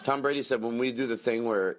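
ordinary delivery

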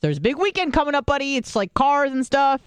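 high, know-it-all explaining voice; explaining the big weekend to a coworker, condescending; schooling him. Retold or imitated. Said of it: "I'm, like, schooling him".

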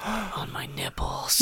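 breathy gasp then whisper; gasp, then whisper it slowly. Pretend. Gasped whispered joke answer.

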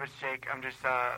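usual speaking voice

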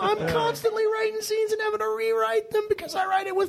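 very high, drawn-out comic wail; mock whining. Writer moaning to his colleagues about his own plot, mock whining. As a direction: whine it out in a squeaky, hammed-up lament.

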